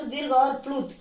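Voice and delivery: ordinary talk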